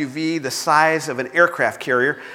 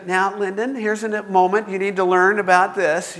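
calm, patient dad teaching his son; 4 semitones higher than usual. Retold or imitated. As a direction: explain it evenly, with no trace of a yell. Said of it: "just calmly says".

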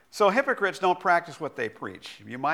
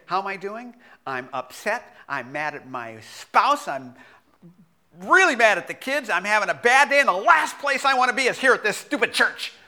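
higher, louder, venting the bad morning; honest frustrated rant; 6 semitones higher, 7 dB louder than usual. A churchgoer admits anger at family, louder frustrated rant. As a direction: let the irritation build to stupid church. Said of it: "You'd say".

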